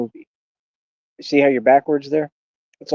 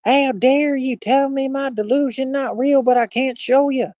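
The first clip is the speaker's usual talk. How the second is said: mock whiny voice, pitched far higher and slowed; mocking Ralph Bottles; 11 semitones higher, slower than usual. Mocking the rival who denies his cars, a high mock whine. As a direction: play him as a sulking loser.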